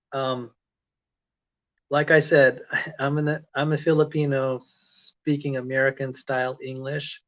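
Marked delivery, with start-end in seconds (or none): none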